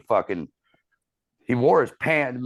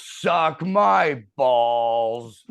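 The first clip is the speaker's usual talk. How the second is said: slow, a bit louder mock yell; retold yell; 5 semitones higher, 5 dB louder, much slower than usual. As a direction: yell it slowly into the song. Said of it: "he yells".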